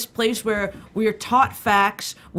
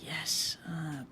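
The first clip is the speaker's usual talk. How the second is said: whispered; 7 semitones lower, 16 dB quieter than usual. Real, not acted. Whispered yes then laugh.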